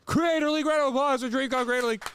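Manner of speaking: big over-the-top show opener; hype announcer. Acted. Podcast intro announcer.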